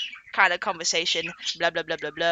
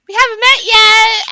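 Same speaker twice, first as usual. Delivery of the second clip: high, loud, drawn-out greeting; smiley lady; 13 semitones higher, 10 dB louder than usual. Retold or imitated. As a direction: voice a smiley little lady, pitched way up. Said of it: "the purple smiley lady is like".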